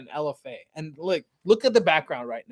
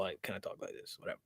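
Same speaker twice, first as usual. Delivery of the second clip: casual mumble; 8 semitones lower, 20 dB quieter, faster than usual. Shown as a demonstration. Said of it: "when I'm just talking in general".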